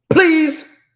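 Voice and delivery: high, loud drawn-out plea; loud please. Real, not acted. Host begging mothers, loud high please. Pleading emphasis after command.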